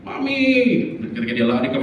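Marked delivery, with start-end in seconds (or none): 0.1-0.4 high-pitched call for mom; acted child's plea to mother; child calling for mommy. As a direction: cry out high like a little child. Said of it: "ada maminya ternyata".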